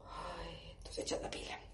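resigned whisper. Retold or imitated. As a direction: sigh it out under your breath.

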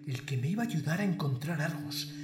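normal talking voice

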